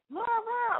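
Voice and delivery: high-pitched garbled catcall; mock yell. Passerby yelling at her from a car, mock yell. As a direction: imitate a yell from a passing car. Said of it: "yells something at me".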